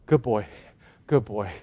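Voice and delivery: low, soft pat-the-animal praise; hushed, chuckling praise; praising a donkey. Pretend. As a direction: murmur it to a loyal animal. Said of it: "I would be going".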